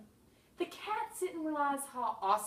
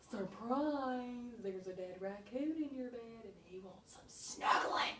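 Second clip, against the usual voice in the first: whispered surprise; slower than usual. Pretend. Raccoon on Victor's pillow whispering a surprise. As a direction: whisper it like a creepy secret.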